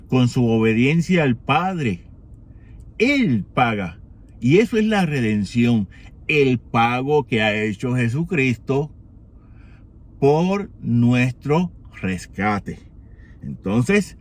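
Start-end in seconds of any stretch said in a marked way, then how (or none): none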